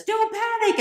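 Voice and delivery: high, loud squeal of panic; a magician squealing in panic at the others; panicky shout. Acted. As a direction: shriek it in a rising panic.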